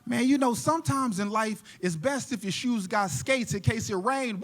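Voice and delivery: ordinary delivery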